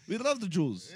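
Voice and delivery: bad, hammed-up German accent; horrible German accent. Co-host voices the rebranded party, horrible German accent. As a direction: try a clumsy mock German accent. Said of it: "horrible German accent".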